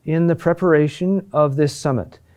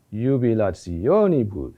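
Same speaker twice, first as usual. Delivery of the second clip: JUBILATIONIBUS lower, with a pitch swell on the O, then falling; accent on O; 4 semitones lower than usual. Shown as a demonstration. Teacher repeating the Latin example, "jubilationibus" peaking on the O. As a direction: let "jubilationibus" crest on the O and drop away. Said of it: "The accent is on O".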